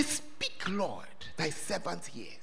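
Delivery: soft, near-whispered answer to God; hushed reply. Retold or imitated. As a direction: breathe the words like a humble servant. Eli teaches the boy a reply.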